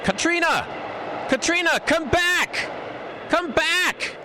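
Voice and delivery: shouting her name into the storm; frantic far-carrying calls; calling out. Acted. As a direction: yell after her, desperate and high. Calling after her into blizzard.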